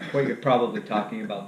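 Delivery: usual speaking voice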